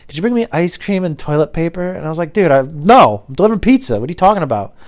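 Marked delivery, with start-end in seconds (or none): 0.1-1.9 lightly imitated caller, pitched up; customer's request. Caller asking the pizza driver for errands, lightly imitated higher voice. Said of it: "he's like". 2.3-4.7 loud, raised-pitch mock protest; incredulous refusal. Pizza driver refusing a caller's errand, incredulous and loud. Retelling his refusal to customer.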